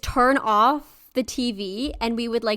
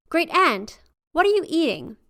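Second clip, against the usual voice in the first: calling higher into the dark; 6 semitones higher than usual. Acted. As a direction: call out nervously to the next room. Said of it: "she called out into the darkness".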